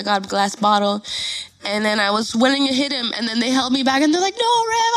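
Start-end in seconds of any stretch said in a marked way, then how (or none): 4.4-4.9 high-pitched pleading no; friends restraining her. Retold or imitated. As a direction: imitate friends stopping a fight. Acts friends holding her back.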